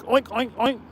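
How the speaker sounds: soft mocking oinks; mock pig oinks. Calling Reg the wild pig, quiet mock oinks. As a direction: oink it softly, like a pig squealing to cops. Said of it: "He was the wild pig".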